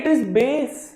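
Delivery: bass pronounced "base"; correct AY vowel. Shown as a demonstration. Teacher correcting a heckling student, bass as "base". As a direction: give bass a long ay. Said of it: "the correct pronunciation is bass".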